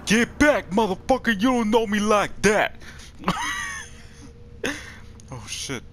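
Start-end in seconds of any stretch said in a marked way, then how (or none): 0.0-2.7 defiant shout. Real, not acted. Player yelling at monsters, defiant shout. 2.8-3.8 high squeaky burst of laughter. Real, not acted. Gamer laughing after the standoff, squeaky laugh.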